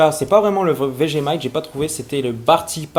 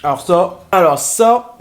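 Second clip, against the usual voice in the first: excited; 3 semitones higher, 6 dB louder, slower than usual. Real, not acted. Delighted after tasting mix.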